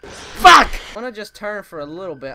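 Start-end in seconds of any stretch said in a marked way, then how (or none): 0.0-0.7 loud terrified curse; scream. Real, not acted. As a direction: shout at the scare.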